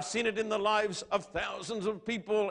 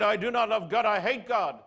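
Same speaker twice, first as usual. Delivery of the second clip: retold defiant outburst; 4 semitones higher, 5 dB louder than usual. Luther defying his confessor.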